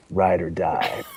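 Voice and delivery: low, quiet action-hero growl; understated tough-guy movie voice; Vin Diesel impersonation. Acted. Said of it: "My best Vin Diesel impersonation".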